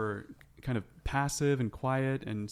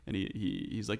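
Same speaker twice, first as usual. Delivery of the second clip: usual speaking voice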